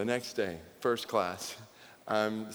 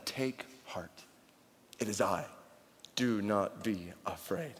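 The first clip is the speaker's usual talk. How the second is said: gentle Jesus quote; 4 semitones lower, 8 dB quieter, slower than usual. Acted.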